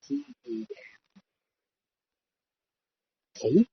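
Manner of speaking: slow letter by letter; drawn-out spelling of the letters; spelling slowly. Retold or imitated. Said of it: "He's like".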